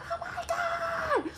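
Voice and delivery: high, drawn-out mock gasp; shocked gasp. Sister seeing her brother kicked, high shocked gasp. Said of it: "his sister's like".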